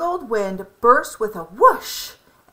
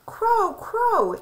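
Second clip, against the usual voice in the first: high calling out to Crow; 12 semitones higher than usual. A narrator's reading. Lolly calls to Crow, high and eager. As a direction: call across to the bird.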